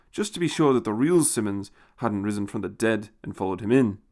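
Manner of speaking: plain reading voice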